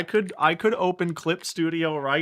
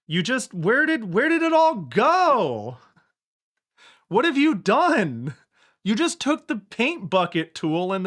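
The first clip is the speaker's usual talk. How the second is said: much higher, louder, exasperated cry; outraged disbelief; 7 semitones higher, 5 dB louder than usual. Real, not acted. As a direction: wail at the ruined art. Outraged at flattened art.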